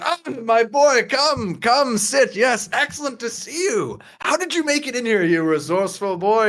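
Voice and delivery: put-on high, lilting, blurry-eyed old relative; effusive grandfather. Grandfather greets Enzo in the smoky room, effusive and high. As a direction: gush a welcome at a beloved grandson. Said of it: "he says".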